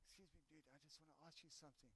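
very hushed, low, hurried request; imitating quiet aside. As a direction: sneak in a barely audible interruption.

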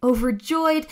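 ordinary delivery, nothing marked

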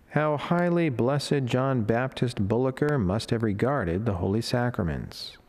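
normal talking voice

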